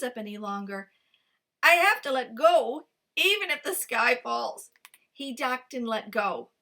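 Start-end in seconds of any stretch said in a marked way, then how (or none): 1.6-4.7 straining. Acted. Fox strained holding up rock.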